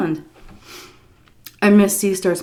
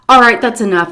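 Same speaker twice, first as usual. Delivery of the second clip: louder, higher, putting a stop to it; firm intervention; 5 semitones higher, 9 dB louder than usual. Retold or imitated. Daughter-in-law stepping in to help, firm. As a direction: step in with brisk authority.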